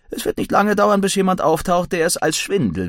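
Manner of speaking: put-on high-pitched, breezy young man; Billy. A narrator's reading. Billy reassures narrator.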